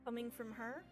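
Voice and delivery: ordinary talk, nothing marked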